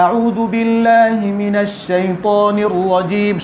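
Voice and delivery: slow chanted recitation from a low starting note; low-pitched recitation. Shown as a demonstration. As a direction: intone it slowly, starting low. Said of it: "তো আমরা নিচে থেকে ধরবো".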